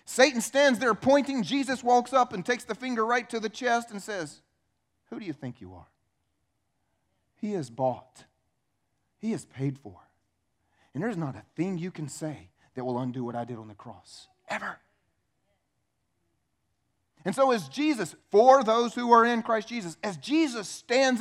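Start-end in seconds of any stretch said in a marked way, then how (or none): 5.1-14.8 low, soft and stern; calm quiet confrontation; quiet authority. Pretend. Jesus confronting Satan, finger on chest.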